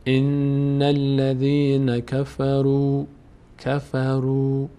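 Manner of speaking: slow chant, الف of الذین left silent; recitation demo. Shown as a demonstration. Teacher answering his own question, slow chant. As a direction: recite slowly, joining into الذین.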